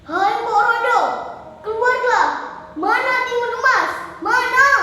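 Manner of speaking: loud, high demanding shout; angry giant. Acted. Giant orders Mbok Rondo out and hunts Timun Emas, bellowing. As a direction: bellow each short demand.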